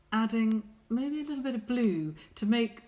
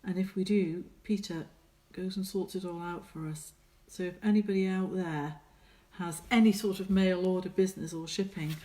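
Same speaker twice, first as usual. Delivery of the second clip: ordinary delivery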